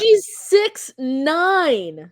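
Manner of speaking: stretched-out stunned six nine; astonished. Real, not acted. Co-host reading Griner's height, drawn out in disbelief. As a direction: drag it out in disbelief.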